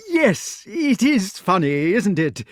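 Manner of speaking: high, loud, slow, anxious agreeing; nervous forced agreement; a worried host laughing off a suspicion; anxiously. A narrator's reading. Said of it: "said Sir Ector anxiously".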